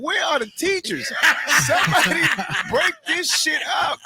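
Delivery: high, laughing plea for help; comic laughing desperation; laughing mock panic. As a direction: beg for rescue while laughing.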